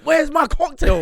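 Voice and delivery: high-pitched mock yell of a fed-up customer; imitated shout. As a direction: call it out high, imitating an impatient friend. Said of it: "One time he shouted".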